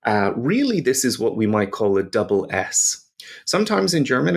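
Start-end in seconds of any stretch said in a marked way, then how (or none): none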